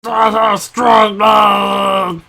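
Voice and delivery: slow, shouted strongman boast; strongman impression. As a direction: bellow it like a proud strongman. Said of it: "pretty sweet strong man impression".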